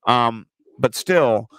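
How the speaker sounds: normal talking voice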